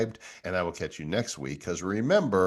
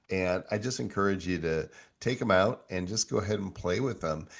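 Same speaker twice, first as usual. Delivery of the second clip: ordinary talk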